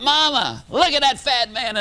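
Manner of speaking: put-on high-pitched little kid calling out; mock child's yell, high pitch; screaming four-year-old. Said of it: "in a voice that you could hear ... screamed out".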